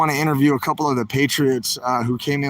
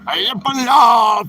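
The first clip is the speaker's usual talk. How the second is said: loud, slow call, higher up; 6 semitones higher, 7 dB louder, slower than usual. Real, not acted. Host calling down to the sneaker convoy, loud and slow. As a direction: shout it down to someone below.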